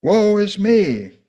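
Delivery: loud, high, drawn-out lament; anguished cry. Acted. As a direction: cry it out in despair. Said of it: "Isaiah cried".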